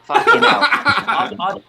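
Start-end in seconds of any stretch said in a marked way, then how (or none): 0.0-0.6 loud, high laughing outburst; laughing curse. Real, not acted. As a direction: burst out laughing as you swear. Laughing exclamation after boast.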